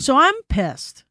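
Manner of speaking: slow, heavy, with weight on pissed; emphatic. Retold or imitated. Retelling the bad motel night, stressing "pissed". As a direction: lean on "pissed" slowly, a touch angry.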